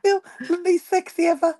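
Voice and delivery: laughing through the words. Real, not acted. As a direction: say it while giggling. Joking about her croaky voice.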